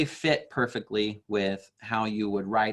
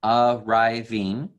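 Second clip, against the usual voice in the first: "Arriving" stretched slowly and louder, each syllable marked; counting syllables; 5 dB louder, much slower than usual. Shown as a demonstration. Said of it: "is three more".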